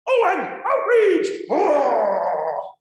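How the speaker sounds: gleeful put-on indignation; mock outrage. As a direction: play a Facebook user relishing being outraged. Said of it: "they wanna get outraged".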